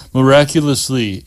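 usual speaking voice

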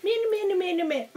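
high chirpy me me; bird imitation. Mimicking the bird's chirps.

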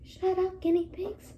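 soft, high-pitched telling-off; small, held-back complaint; restrained scolding. Real, not acted. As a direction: quietly shush the noisy pets. Tells noisy pets off, first softly.

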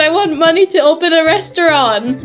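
high, laughing plea; pleading high girl voice; begging Cherry voice. Acted. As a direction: beg through giggles.

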